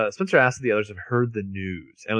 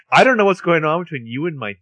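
louder, higher angry line; loud angry retort; 6 semitones higher, 5 dB louder than usual. Retold or imitated. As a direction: snap it out angrily. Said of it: "Or, I'm sorry".

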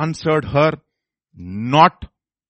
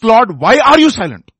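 high, very loud plea to God; raised, urgent demand for an answer; crying out; 8 semitones higher, 11 dB louder than usual. Shown as a demonstration. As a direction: preach it as a forceful cry.

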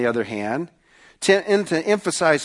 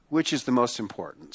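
soft repeated question; 5 semitones lower, 8 dB quieter than usual. Real, not acted. Preacher repeats question softly.

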